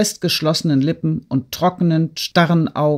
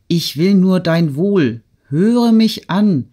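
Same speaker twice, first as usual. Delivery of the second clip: urgent appeal; 4 semitones higher, 5 dB louder, slower than usual. A narrator's reading. Urging Natalie to listen.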